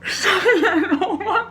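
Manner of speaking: high, loud, half-laughing cry; overwhelmed disbelief. Real, not acted. Woman to her friend after the positive test, overwhelmed disbelief. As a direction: call out high and loud, nearly overcome. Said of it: "in complete disbelief".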